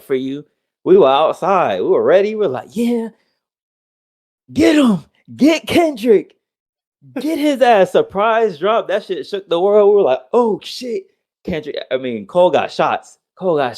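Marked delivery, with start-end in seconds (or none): 2.8-7.9 high-pitched egging-on cheer; excited fan yelps, pitched way up; hyped-up fans. Retold or imitated. Acting out fans cheering on attack. 10.3-11.1 high-pitched mock shock; imitating amazed onlookers, voice pitched up; shocked fans. Acting out fans' shocked reaction.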